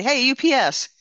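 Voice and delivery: loud, high call across the street; yelling to driver. Retold or imitated. Woman calling out to the UPS driver, loud high shout. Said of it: "I yelled at him".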